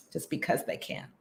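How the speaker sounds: a dry whisper under the breath; bitter aside whispered about the deadline change. Real, not acted. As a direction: toss it off quietly, almost to yourself.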